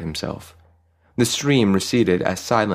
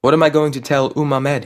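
high, plaintive wail; mildly anguished, pitched-up complaint; moaning; 7 semitones higher, 4 dB louder than usual. A narrator's reading. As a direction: fret aloud about facing your wife. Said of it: "he moaned".

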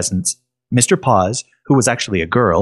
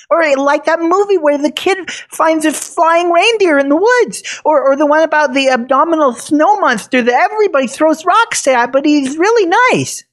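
put-on high, eager kid voice; bright excited little boy; young boy voice. Nate, a boy, speaks.

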